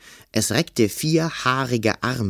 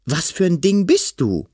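put-on high, agitated boy's question; alarmed demand; 9 semitones higher, 4 dB louder than usual. A narrator's reading. Boy asking the monster what it is, agitated high voice. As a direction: challenge the creature, pitched high.

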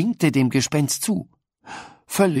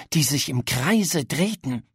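ordinary delivery

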